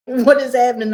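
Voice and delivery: a touch of alarm; retold panic. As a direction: ask in rising panic. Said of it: "I just started screaming".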